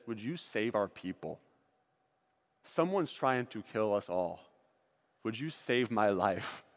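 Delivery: low, soft, hushed plea; pleading queen. Acted. Esther begs the king to save her people, hushed. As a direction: beg gently for your life.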